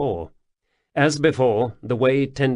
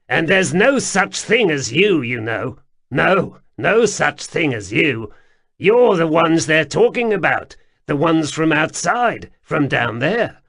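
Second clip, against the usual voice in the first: higher and louder, excited insistence; agitated underground man; 6 semitones higher, 6 dB louder than usual. A narrator's reading. Wemreddle insists to the strangers, higher and agitated. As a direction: wag a finger, insist there's no such thing.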